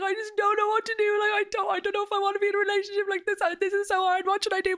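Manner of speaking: high, wailing mock sob voice; teary high-pitched whine; imitating crying friend. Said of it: "And she'd be like".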